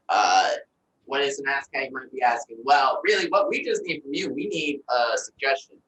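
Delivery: ordinary talk, nothing marked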